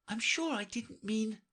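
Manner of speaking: put-on high, light girlish voice; Alice voice. A narrator's reading. Alice to herself, dreaming of being queen, in a high girlish voice. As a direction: read Alice eager and light.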